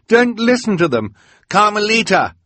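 high-pitched, loud pleading shout; urgent cry. A narrator's reading. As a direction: cry a warning at full pitch. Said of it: "Klaus cried".

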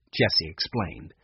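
usual speaking voice

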